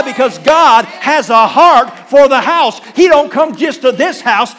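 loud, impassioned preaching. Acted. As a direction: preach it out with full fire. Preaching at full force.

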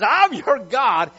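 loud, forceful growled declaration. Acted. Preacher acting God proclaiming He heals, loud and growling. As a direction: stretch it out in a powerful growl. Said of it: "with a growl in His voice even".

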